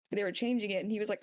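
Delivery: usual speaking voice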